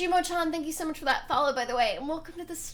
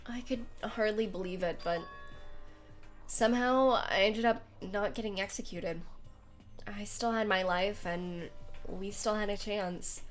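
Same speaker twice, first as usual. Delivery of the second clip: story narration; 5 dB quieter than usual. A narrator's reading. Reads visual novel narration.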